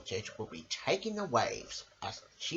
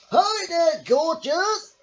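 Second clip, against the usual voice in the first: much higher, louder cheeky shout; windsurfer shout; 14 semitones higher, 12 dB louder, much slower than usual. Acted. Windsurfer shouts a cheeky hello to Missus Armitage. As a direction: holler it as you speed past. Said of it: "he shouted".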